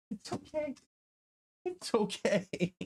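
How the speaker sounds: soothing the dog. Real, not acted. Comforting dog scared of thunder.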